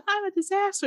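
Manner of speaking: high, gasping melodramatic squeal; mock distraught wail. Host mimics the heroine's meltdown, mock distraught wail. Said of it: "That's what she sounded like".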